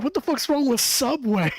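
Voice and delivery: high, loud, offended yell; indignant outburst. Retold or imitated. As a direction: fire back in disbelief. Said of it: "I'm like".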